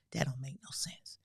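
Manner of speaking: usual speaking voice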